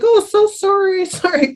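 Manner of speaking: high, breezy mock apology with a laugh; mocking lip service. Mimicking people who brush off her apology, high and mocking. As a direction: toss off a fake sorry, laughing. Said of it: "everybody's like".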